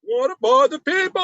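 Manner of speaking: high and loud whiny comic rise; Seinfeld impression. Copying the Seinfeld bit with a high whiny rise. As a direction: do a standup-comic whine.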